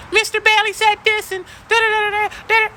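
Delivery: high, squeaky imitation of a teen; student telling the secretary, mocked squeaky; mock student tattling. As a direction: squeal it like a dramatic kid. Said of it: "and she's like".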